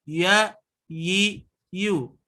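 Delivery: "Ya, yi, yu" said gently, unpressed; soft ya yi yu. Shown as a demonstration. Teacher showing the soft lin sound, gentle. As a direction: glide softly through "Ya", "yi", "yu". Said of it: "Tapi lembut".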